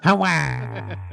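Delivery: mock German accent with a guttural ch; a hammed-up German exclamation in a German accent; bad German accent. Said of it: "That's my best German".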